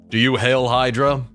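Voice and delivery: usual speaking voice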